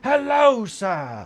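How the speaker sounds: high, booming HELLO dropping on sir; jump-scare bellow, imitating the bathroom attendant; startlingly loud greeting. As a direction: boom out a drawn-out greeting to startle someone. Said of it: "that's how loud he says it".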